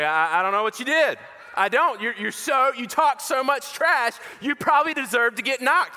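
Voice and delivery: high-pitched, halting motherly exasperation; scolding mom. Retold or imitated. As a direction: act out a sweet mom blaming her son. Mom scolds her trash-talking son.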